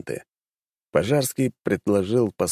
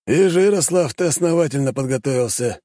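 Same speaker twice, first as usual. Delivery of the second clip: higher, louder respectful greeting; respectful soldier; 7 semitones higher, 3 dB louder than usual. A narrator's reading. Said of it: "с уважением сказал он".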